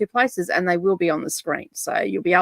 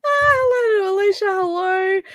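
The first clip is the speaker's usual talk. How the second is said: high, drawn-out delighted greeting; squealed hello; 12 semitones higher, much slower than usual. Real, not acted. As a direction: greet her with a happy squeal. Greeting a viewer.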